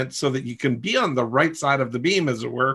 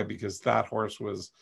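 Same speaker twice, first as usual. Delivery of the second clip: choked up; 2 semitones lower, 11 dB quieter than usual. Real, not acted. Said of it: "now I'm gonna cry".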